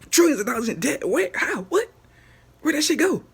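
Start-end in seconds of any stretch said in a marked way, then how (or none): 2.6-3.2 high, loud outraged question; real disbelieving shout; indignant yell. Real, not acted. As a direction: demand an answer, shouting. Exasperated rant about government debt.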